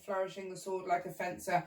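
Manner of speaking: ordinary delivery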